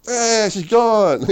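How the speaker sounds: high, slow mock letdown; comic aside after the woman leaves, mock letdown; mock deflated. Punchline after failed line.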